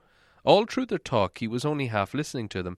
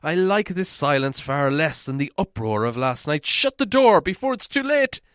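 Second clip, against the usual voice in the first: high, louder urgent plea; uneasy pleading; 8 semitones higher, 5 dB louder than usual. A narrator's reading. Bilbo urging the dwarves to shut the door, high and fearful. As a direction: press them, scared of the dragon. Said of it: "Something in his voice gave the dwarves an uncomfortable feeling".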